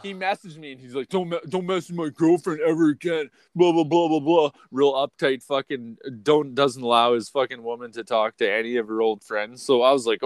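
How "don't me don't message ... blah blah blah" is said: higher-pitched imitation of a warning; mock territorial rant; mocking jealous boyfriend. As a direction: parody his scolding, pitched up into blah-blahs.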